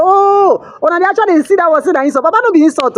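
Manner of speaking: high-pitched "oh" of sudden understanding; retold surprised realisation. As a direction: act out the penny dropping, pitch way up. Retold realisation that it's no insult.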